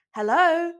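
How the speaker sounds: loud, high call for attention; yelled. Acted. Nami yelling at the table to get attention. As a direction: shout to cut through the chatter. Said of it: "Nami yelled".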